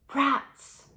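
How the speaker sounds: high, loud hiss. Acted. Terrified Jane naming what she feared, loud hissing voice. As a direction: spit the word out like a hiss. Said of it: "in a strange hissing voice".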